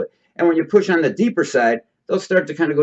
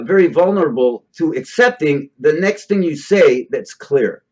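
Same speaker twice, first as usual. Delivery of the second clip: normal talking voice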